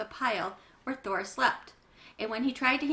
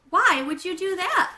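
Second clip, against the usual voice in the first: loud, high, disbelieving ask; startled question; 9 semitones higher, 10 dB louder than usual. Acted. Mom shocked that Bat took the pajama top, loud disbelief. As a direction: react in shock.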